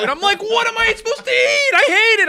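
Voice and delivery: shrill mock complaint about the food; whiny high mock complaint. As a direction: play a whiny picky eater who won't eat it. Mocking his own reaction.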